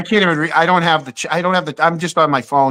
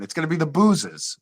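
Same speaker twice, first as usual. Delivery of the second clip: broad Boston accent saying boozers; Boston accent, dropping the r in boozers; 7 dB quieter than usual. Shown as a demonstration. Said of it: "you gotta do it with the Boston accent".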